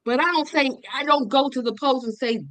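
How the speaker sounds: normal talking voice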